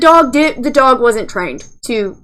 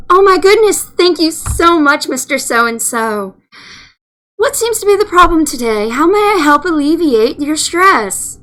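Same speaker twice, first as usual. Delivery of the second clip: syrupy phone agent; 4 semitones higher than usual. Retold or imitated. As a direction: gush at the caller like an overeager phone rep. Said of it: "that just sounds so wrong".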